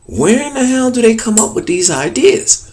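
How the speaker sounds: imagine a puzzled viewer's exclamation; baffled. Pretend. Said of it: "sitting there scratching your head like".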